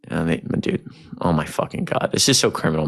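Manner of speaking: ordinary delivery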